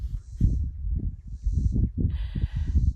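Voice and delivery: loud, breathy laughing fit; real uncontained laughter; joyful laugh. Real, not acted. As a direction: break up laughing mid-sentence. Laughing mid affirmation.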